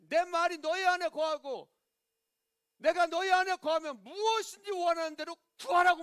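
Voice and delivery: high, ringing preaching voice; declaimed scripture. Real, not acted. Preacher quoting Jesus' promise to believers, high declaiming voice. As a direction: proclaim the verse to the congregation.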